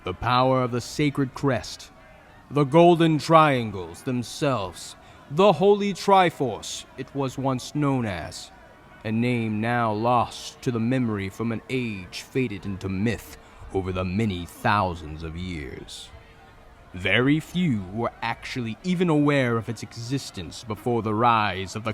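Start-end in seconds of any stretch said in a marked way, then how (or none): none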